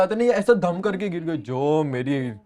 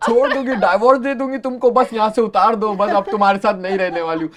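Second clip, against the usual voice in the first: higher, louder laughing mock shout; imitating wife's yell; 5 semitones higher, 5 dB louder than usual. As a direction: mimic her hysterical threats. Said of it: "बोलती". (हँसते हुए पत्नी की चीख की नकल)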